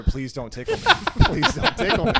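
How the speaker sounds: retold begging broken up by laughter; laughing retold plea.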